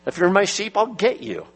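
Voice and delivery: lightly voiced Christ speaking to his sheep; voicing Christ. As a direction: promise it like Christ would. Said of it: "Christ says".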